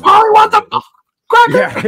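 high squawking parrot cursing. Pretend. Imitating swearing parrot.

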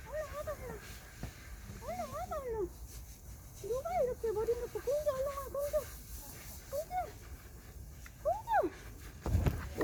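high, soft coaxing call. Real, not acted. Mother coaxing her pet Gongju to come, high soft call.

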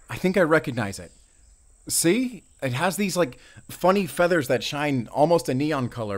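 quick, high and loud, pointing something out; eager rush, spotting the crow; excited. Acted. Ranger spotting the crow, excited and loud.

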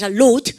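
"load" said as a distinct single word; pronunciation model. Shown as a demonstration. Teacher has class repeat the vocabulary word, pronunciation model. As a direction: model "load" for learners to copy. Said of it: "발음 갖다".

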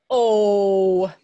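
"oh" loud and held; a big, rounded, stretched "oh"; long O sound. Shown as a demonstration. Teacher showing students the O sound, sounded out. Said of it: "O can make an O sound".

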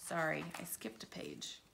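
quick whisper to the listeners; a real hushed aside; whispering an apology. Real, not acted. Reader's aside after skipping page.

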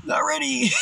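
mock shout. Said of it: "I was like".

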